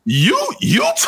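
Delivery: very high shouted challenge; mock shout. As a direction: yell it in disbelief.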